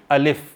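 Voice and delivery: अलिफ spoken as a plain letter name, a short a then lif; letter name alif. Shown as a demonstration. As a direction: say अलिफ as in huruf muqattaat. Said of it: "कैसे पढ़ेंगे?".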